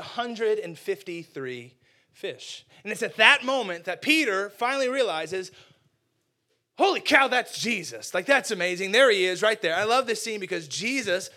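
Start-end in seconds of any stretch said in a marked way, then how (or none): 2.8-9.7 loud, high burst of amazement; excited realization. Acted. Preacher voicing Peter spotting Jesus, excited and loud. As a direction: gasp it out like you just spotted him.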